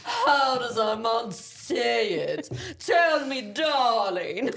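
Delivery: put-on loud hammy French accent; slow, laughing French accent. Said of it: "I can't stop doing the accents".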